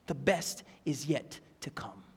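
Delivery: low whispered promise; hushed whisper. Real, not acted. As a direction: drop to a whisper for emphasis. Hushed refrain after raised exhortation.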